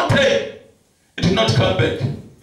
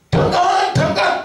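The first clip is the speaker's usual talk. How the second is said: retold shout; 9 semitones higher than usual. Preacher re-enacts shouting in the bush for the lost sheep, high calling shout. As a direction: call a name far across the bush. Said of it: "I started to shout".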